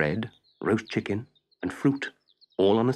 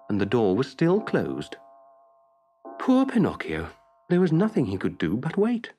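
usual speaking voice

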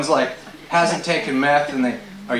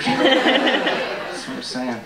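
slow stone monotone; 7 semitones higher, much slower than usual. Pretend. Drawn-out stoner catchphrase.

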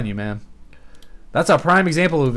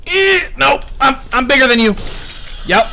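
taunting; 12 semitones higher, 5 dB louder than usual. Real, not acted. Taunts the killer mid-chase.

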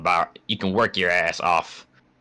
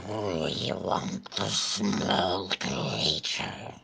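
slow, soft creature voice; 7 dB quieter, much slower than usual. Pretend. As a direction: draw it out like a strange beast. Voicing game creature.

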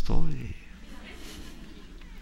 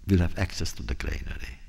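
a solemn lower voice; grave, low king; 6 semitones lower than usual. Acted. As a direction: weigh each word like a last wish. King's grave final request.

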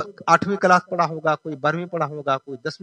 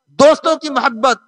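insistent, raised preaching voice; full-force preaching; 7 semitones higher, 5 dB louder than usual. Real, not acted. Preacher challenges congregation about worldly loves, rousing sermon. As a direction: drive each item home like a preacher.

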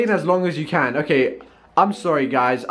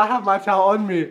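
ordinary talk, nothing marked

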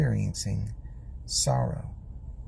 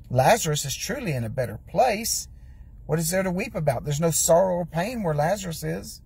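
rising preaching fervor; 4 semitones higher than usual. Acted. Preaching builds to a higher pitch.

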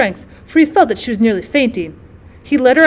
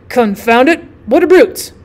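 exasperated curse; 4 dB louder than usual. A narrator's reading. Breathless farmer cursing his stubborn cow, exasperated. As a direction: curse the brute out loud.

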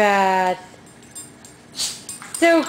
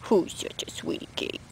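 whispering; 4 semitones lower, 12 dB quieter than usual. Real, not acted. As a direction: whisper it sweetly to the pet. Cooing to dog.

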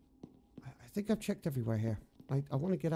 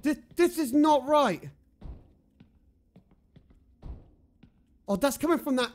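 scared; 10 semitones higher, 7 dB louder than usual. Real, not acted. Scared by noises in game.

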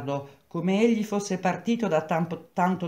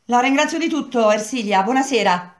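loud, stiff thanks; 7 semitones higher, 6 dB louder than usual. Acted. Angry girl storms out thanking.

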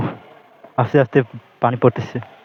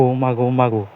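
ordinary talk

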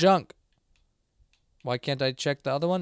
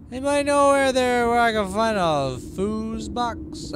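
higher, slow pleading call; plaintive call; 7 semitones higher, 6 dB louder than usual. Real, not acted. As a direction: call out helplessly for help. Calls out to chat for help.

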